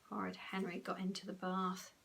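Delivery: ordinary delivery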